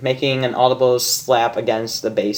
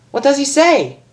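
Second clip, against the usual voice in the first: much higher, eager question; eager Rainbow; 12 semitones higher than usual. Acted. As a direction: lean in, dying to know.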